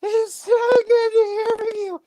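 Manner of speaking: put-on very high, drawn-out little-girl squeal; Marty talking like she's twelve. Sister phoning her younger brother, exaggerated twelve-year-old voice. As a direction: imitate a gushing sister in piercing falsetto. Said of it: "She talks like she's twelve".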